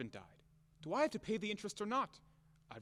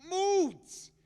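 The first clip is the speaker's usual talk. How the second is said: much higher and louder, mocking; scornful outburst; 7 dB louder than usual. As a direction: fling the word out in disgust. Mocking her excuse.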